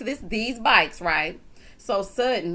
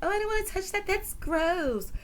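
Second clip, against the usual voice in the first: mock prissy complainer; 10 semitones higher than usual. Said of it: "It's always like".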